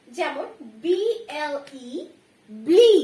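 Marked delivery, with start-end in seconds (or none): 2.5-2.9 ব্লি said with a short i vowel; ble with i. Shown as a demonstration. Teacher modelling the short i vowel in ব্লি. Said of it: "হর্ষিকার".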